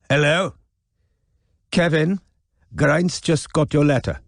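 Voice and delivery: a faltering fake Welsh; wobbly Welsh accent. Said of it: "her accent wobbled somewhere between Cardiff and Lahore".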